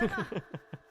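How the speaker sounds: soft, high chuckle; self-amused laugh. Real, not acted. Streamer joking about the YouTube accent, soft chuckle. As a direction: chuckle softly at your own joke.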